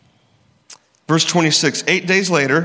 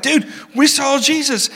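excited disciples; 8 semitones higher than usual. Acted. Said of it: "They're like".

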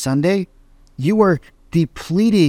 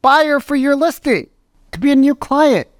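high, pressing voice, pleading with him to pick up; anxious urging; 12 semitones higher than usual. Retold or imitated. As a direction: urge him frantically to answer his phone. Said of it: "I'm like".

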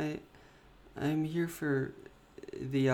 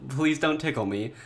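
ordinary talk

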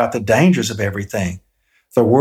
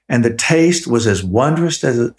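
ordinary delivery

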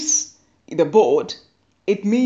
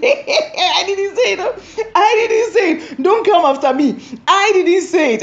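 laughing denial; 10 semitones higher than usual. Real, not acted. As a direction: laugh through the denial, don't come after me.

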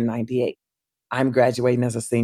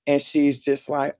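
ordinary talk

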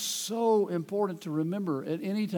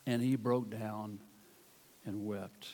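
sorrowful narration; 8 semitones lower, 7 dB quieter, slower than usual. A narrator's reading. Peter weeps, reading slows.

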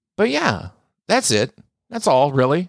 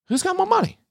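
mock squeaky question; faster than usual. Mocking rich people's money obsession, squeaky high mock. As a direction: jump way higher into a silly squeak.

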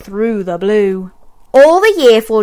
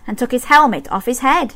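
plain reading voice

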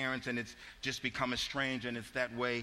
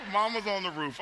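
higher and louder, punchline through laughter; amused replay of the punchline; laughing repeat; 7 semitones higher, 4 dB louder than usual. Real, not acted. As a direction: relish the line again while laughing. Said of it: "repeats punchline".

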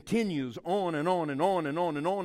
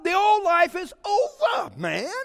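"It's over" proclaimed high and loud, with a falling finish; excited proclamation; 14 semitones higher, 9 dB louder than usual. Real, not acted. Preacher rousing the congregation, with an excited high loud proclamation. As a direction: shout it out like a triumphant preacher.

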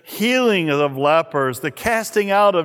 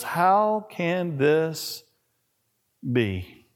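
despairing question; 3 dB quieter, much slower than usual. Retold or imitated. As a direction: let the question collapse into grief. Broken disciples' despairing question.

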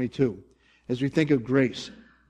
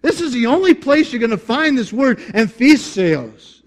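much higher, louder burst of amazement; amazed discovery; 9 semitones higher, 6 dB louder than usual. Retold or imitated. Preacher re-enacting his own study discovery, amazed. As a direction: react as if you just found a rare treasure. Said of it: "whenever I see something like that".

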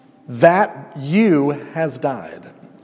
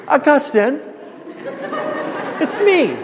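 hammed-up mistress voice again; 12 semitones higher than usual. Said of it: "You like this acting job I'm doing?".

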